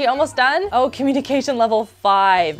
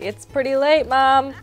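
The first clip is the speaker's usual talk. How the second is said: put-on higher-pitched little kid voice; tired child; 5 semitones higher than usual. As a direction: plead sleepily like a small child. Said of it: "She's like".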